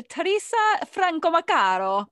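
name said with Italian flourish; Italian pronunciation. Shown as a demonstration. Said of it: "That's how the Italians would say it".

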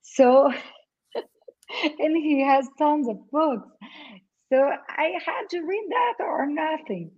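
usual speaking voice

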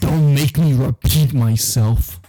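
loud, slow mock scolding; stern boss. Boss warning a new employee, stern. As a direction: lay down the law, loud and drawn out.

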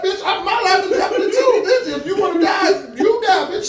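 high-pitched, hammed-up alarm, half laughing; panicked mock protest. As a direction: squeal it like a panicking passenger. Acted protest to reckless driver.

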